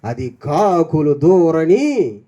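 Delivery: very slow, low, eerie storyteller voice; a granny starting a ghost tale, slow and low; spooky ghost story. Retold or imitated. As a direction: draw out each word to scare the kids. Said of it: "అని ఒక దెయ్యం కథ చెప్తారు".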